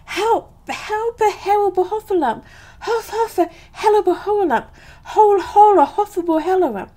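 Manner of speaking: very high, fast, garbled squeals; panicked crying out. A narrator's reading. Piglet scampering from the Heffalump, panicked crying out. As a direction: gabble it in hammed-up Piglet panic. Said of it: "still crying out".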